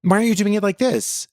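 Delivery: mock bossy voice. Mocking controlling roommate.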